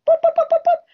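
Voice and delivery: high, loud boops like running heels; imitated heel clicks. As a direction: tap out the heels with your voice. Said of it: "clicking of her heels running".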